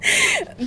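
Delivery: squealing laugh; high cracking laugh. Real, not acted. Host laughing about Bella's anger, high squeal.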